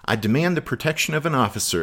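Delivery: high, fast, indignant demand; exclaimed. A narrator's reading. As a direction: exclaim it in a rush, pitch jumping up. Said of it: "exclaimed the civilian".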